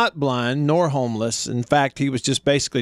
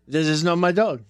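mock French accent; 2 semitones higher than usual. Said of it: "Rednecks doing French accents".

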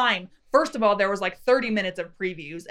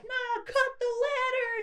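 much higher, softer plea; mock panicked crew; 14 semitones higher, 7 dB quieter than usual. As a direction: squeal the order in mock alarm. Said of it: "they're like".

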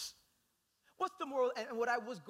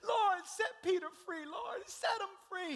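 pleading prayer; 11 semitones higher, 4 dB louder than usual. Acted. Church praying for Peter.

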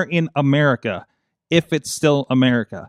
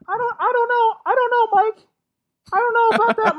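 put-on much higher, stammering nervous teen voice; Morty voice; faster than usual. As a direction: stutter it out in a jittery high pitch. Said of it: "your Morty voice sucks".

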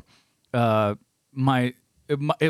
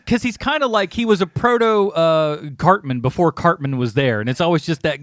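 ordinary talk, nothing marked

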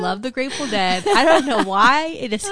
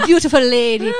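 louder, laughing mock French accent; 7 dB louder than usual. Quoting a French man in Paris, mock French accent. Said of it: "Irish French accent".

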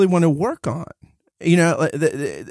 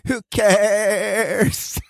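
vocal fry; 6 semitones higher than usual. Retold or imitated. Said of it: "I love that you just did intentional vocal fry".

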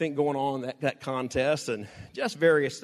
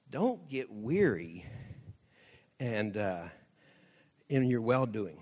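earnest plea; 7 semitones lower, 5 dB quieter, slower than usual. Real, not acted. Slow earnest preaching exhortation.

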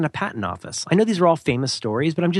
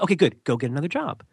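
ordinary talk, nothing marked

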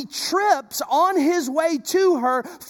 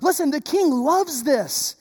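ordinary talk

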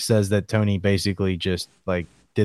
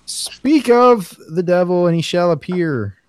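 mock ominous; 6 semitones higher than usual. Ominous greeting as Tony arrives.

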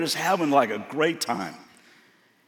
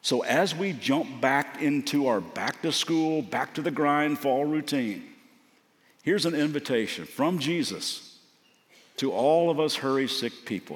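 usual speaking voice

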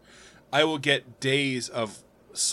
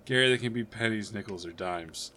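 Broken Will voice; 4 semitones lower, 7 dB quieter than usual. Acted. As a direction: mumble it out like a broken man. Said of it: "Broken Will".

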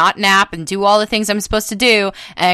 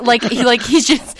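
usual speaking voice

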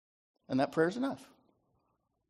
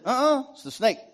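defensive woman; 10 semitones higher, 5 dB louder than usual. Acted. Woman blaming the snake.

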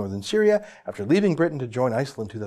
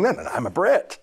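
mock impression of the prisoner; 5 semitones higher than usual. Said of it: "So here's him saying".

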